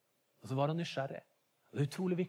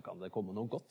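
lower, softer, dry sarcastic jab; mildly mocking, lower and softer; 9 semitones lower, 7 dB quieter than usual. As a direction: scoff at the idea like a doubting friend. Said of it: "sier han sarkastisk".

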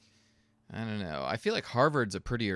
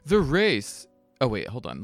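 ordinary talk

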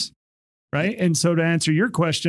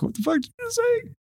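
much higher mock startled retort; startled indignant yelp; 3 dB quieter than usual. Cop startled by a yell from behind, mock startled retort. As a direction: spin round, caught off guard. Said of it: "you turn around and be like".

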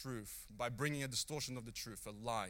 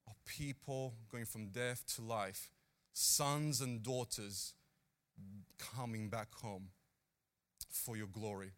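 closing prayer; 3 semitones lower than usual. Real, not acted. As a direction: pray it out gently, phrase by phrase. Closes the talk in prayer.

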